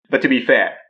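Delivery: ordinary talk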